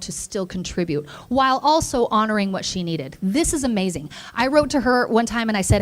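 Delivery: usual speaking voice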